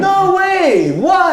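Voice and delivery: very high, loud cry of amazement; astonished high-pitched yell; disbelieving shout. Real, not acted. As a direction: shriek in real disbelief at the goal. Disbelief at a goal just scored.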